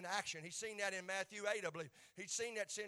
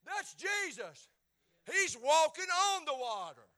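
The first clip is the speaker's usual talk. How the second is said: high, slow, loud excited cry; Peter's excited cry from the boat; excited recognition; 7 semitones higher, 10 dB louder, slower than usual. Acted. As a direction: call out as if you just spotted him. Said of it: "I believe he said".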